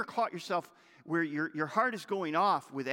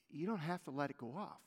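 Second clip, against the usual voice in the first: soft repeat; 3 semitones lower, 8 dB quieter, faster than usual. Acted. Same line repeated softly.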